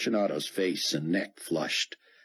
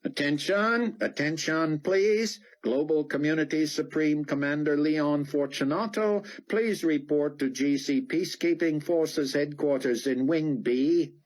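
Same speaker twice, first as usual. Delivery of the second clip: public address announcement; 10 semitones higher than usual. A narrator's reading. Loudspeaker summons for the commander, formal PA voice. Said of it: "announcement came over the public address system".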